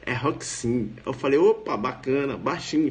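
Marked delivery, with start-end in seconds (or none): 0.1-0.9 "Roxin" low, HOK-sin with ks; correct pronunciation. Retold or imitated. Friend corrects him on the name, correct pronunciation. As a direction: say "Roxin" with ks, not sh. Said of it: "a pronúncia".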